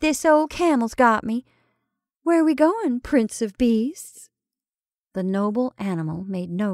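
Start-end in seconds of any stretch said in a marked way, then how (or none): 0.0-4.3 higher, louder playful call. A narrator's reading. Betty leaves her partner for the camel, calling out. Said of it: "she called to her partner".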